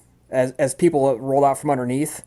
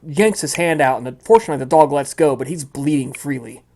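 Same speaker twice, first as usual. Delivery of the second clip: ordinary talk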